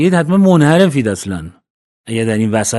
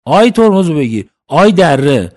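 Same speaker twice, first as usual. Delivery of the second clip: shouted warning, higher and louder; alarmed shout; 4 semitones higher, 4 dB louder than usual. Retold or imitated. Preacher shouts a warning at a driver going into the valley, alarmed yell. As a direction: yell to someone heading for the cliff.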